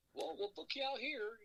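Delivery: high, put-on caller voice; mock Ellsworth. Imitating James Ellsworth introducing himself on the phone, high put-on voice. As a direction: do a light impression of the caller.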